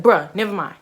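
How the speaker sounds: loud irritated brush-off; mad, imitated. Imitating her date refusing to repeat himself, mad. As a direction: imitate him getting mad and dismissive. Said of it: "he get mad".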